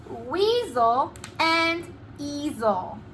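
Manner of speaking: drawn-out board reading; slow spelled-out reading. Shown as a demonstration. Teacher reading the board aloud to children, slowly. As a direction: say it slowly, word by word. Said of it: "write that one on the board".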